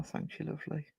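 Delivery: ordinary delivery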